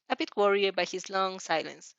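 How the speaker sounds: usual speaking voice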